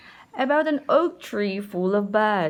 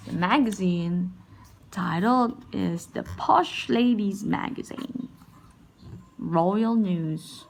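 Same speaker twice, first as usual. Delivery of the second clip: ordinary delivery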